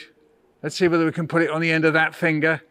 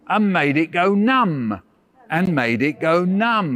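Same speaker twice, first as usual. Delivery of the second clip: slow recitation; slower than usual. Shown as a demonstration. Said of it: "say it after me".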